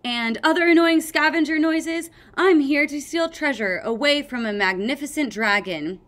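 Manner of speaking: loud mock scavenger boast; hammed-up scavenger. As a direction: announce the theft boldly. Clay playing scavenger.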